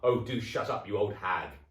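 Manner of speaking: loud, scornful grumble; loud grumpy old man; rude old husband. Acted. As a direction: brush her off with contempt. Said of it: "Mister Twit said".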